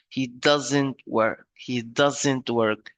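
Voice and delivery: "doesn't" said slowly and drawn out; slow, deliberate weight on "doesn't"; stress on "doesn't". Shown as a demonstration. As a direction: lean on "doesn't", stretching it out. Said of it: "I pronounce the word doesn't in a slow way".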